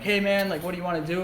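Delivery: high-pitched, eager greeting; retold excitement. Older brother offering little brother a day out, excitedly. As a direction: act out an excited big brother. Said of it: "all excited".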